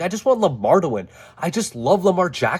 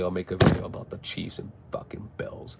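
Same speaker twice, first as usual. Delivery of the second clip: low whispered throwaway line; whispered aside; 8 semitones lower, 9 dB quieter than usual. Real, not acted. Vlogger whispering to viewers about his next video. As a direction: drop it to a whisper as an afterthought.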